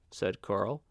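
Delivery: ordinary delivery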